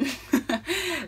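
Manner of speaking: quick burst of laughter; short laugh. Real, not acted. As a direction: let out a short giggle. Laughs after introducing topic.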